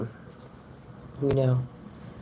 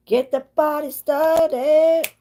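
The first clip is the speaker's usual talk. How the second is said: high, loud, slow party chant; mock party-starter; party hype voice; 6 dB louder, slower than usual. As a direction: belt it like a hype announcer. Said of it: "blowing your horn like".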